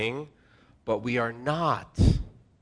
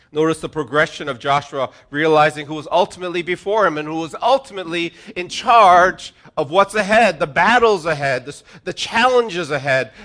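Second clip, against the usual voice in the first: rising, louder preaching build; preaching at full force; 2 semitones higher, 7 dB louder than usual. Real, not acted. Pastor stirring the congregation, building in volume. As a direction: drive the list home, louder.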